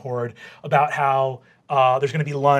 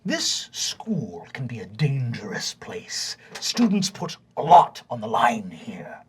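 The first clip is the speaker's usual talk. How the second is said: stern vice principal; 2 semitones lower than usual. Acted. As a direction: savour each word, with long menacing pauses. Vice principal lectures the students.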